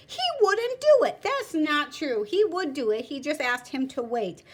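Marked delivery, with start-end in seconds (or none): 0.1-1.2 indignant complaint. Acted. Shopkeeper complaining to magistrate.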